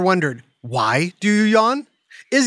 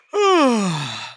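long open-mouthed yawn; big yawn. Real, not acted. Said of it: "Sorry about that. I just woke up".

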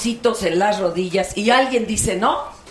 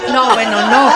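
ordinary talk

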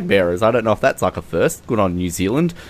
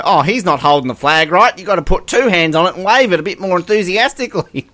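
lightly imitated fussy commentator, pitched up; mock a commentator nitpicking, higher; fussing commentator; 7 semitones higher than usual. Podcaster imitates commentator fussing over flag bearer, mock fussy. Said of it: "every time he would go".

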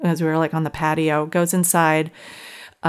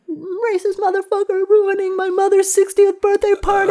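imitating his shrill screaming, much higher and louder; mock high scream; 14 semitones higher, 4 dB louder than usual. Boyfriend on the roof screaming at his family, mock high wail. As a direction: wail it like a grown man throwing a tantrum. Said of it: "man screaming".

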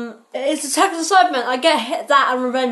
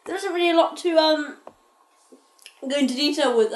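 ordinary talk, nothing marked

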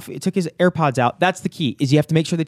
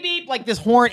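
ordinary talk, nothing marked

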